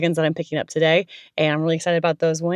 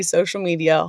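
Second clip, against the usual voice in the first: normal talking voice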